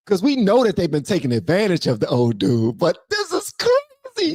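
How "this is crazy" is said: high, slow and drawn out, still in shock; stunned surprise. Real, not acted. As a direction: let the disbelief stretch the words. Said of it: "seeming so, um, surprised".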